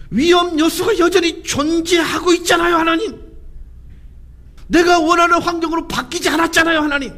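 high shouted plea; desperate protest. Acted. Abraham protests to God that danger remains, shouting desperately. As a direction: cry out in exasperated complaint.

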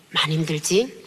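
low, gentle reassurance, slowing at the end; retold divine comfort, low and unhurried; comforting voice of God. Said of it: "음성이 들려요".

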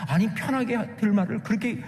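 ordinary talk, nothing marked

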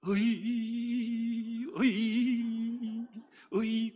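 very slow, drawn-out keening sob sounds; keen from deep in the chest for a mother; a hired mourner wailing for a dead mother. Shown as a demonstration. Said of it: "더 깊이 울어야 돼요".

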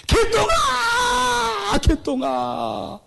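very slow, high crying shout; desperate shout. Acted. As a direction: wail the lost boy's name. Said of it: "고함 지르고". (울부짖으며 아들 이름을 길게 외침)

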